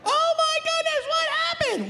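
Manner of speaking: very high falsetto shriek; shocked parent. Retold or imitated. Parents finding smoke in the van, hammed-up falsetto shock. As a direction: shriek it in mock alarm.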